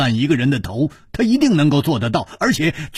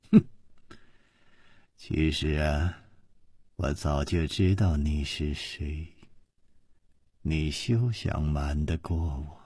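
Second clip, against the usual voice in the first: low, hushed contempt; cold sneer; 11 semitones lower, 10 dB quieter than usual. A narrator's reading. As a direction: sneer quietly from deep in the voice. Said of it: "冷笑地说". (低沉轻声地冷笑着说)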